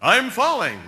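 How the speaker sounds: drawn-out, politely yelled warning; mock warning yell. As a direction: call out a half-hearted mock alarm. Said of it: "alert your partner by yelling".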